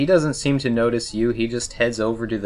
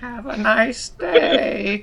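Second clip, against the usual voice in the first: high, slow, sugary goodbye; sing-song farewell; 3 dB louder, much slower than usual. Acted. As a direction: drawl a fake-sweet goodbye. Mock sweet farewell.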